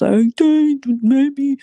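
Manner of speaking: high, slow, drawn-out mock of her. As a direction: stretch it out like her. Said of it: "how she was talking".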